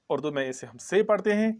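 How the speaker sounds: ordinary talk, nothing marked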